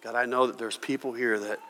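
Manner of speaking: low, hushed prayer voice; closing prayer. Real, not acted. Pastor praying quietly for his listening congregation. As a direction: pray softly over the room.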